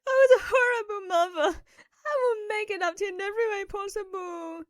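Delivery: mother crying out an apology to her daughter. Acted. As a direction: apologise through sobs. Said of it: "My mum started crying".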